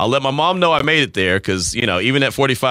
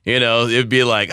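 usual speaking voice

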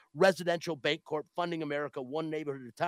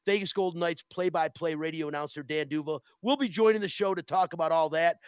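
ordinary delivery

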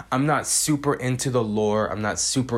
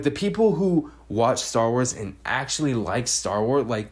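ordinary talk, nothing marked

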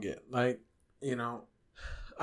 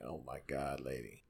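exasperated mutter; 11 semitones lower, 17 dB quieter than usual. Retold or imitated. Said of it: "I'm just like".

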